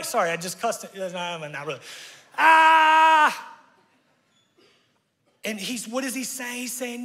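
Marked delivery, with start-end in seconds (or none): 2.4-3.6 frustrated yell. Acted. Frustrated outburst.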